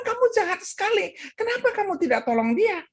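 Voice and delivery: fast, high-pitched retold scolding; angry pastor. Pastor scolds the confessing old man, angry. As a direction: rush out the outraged rebuke. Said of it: "Marahlah ini pastor".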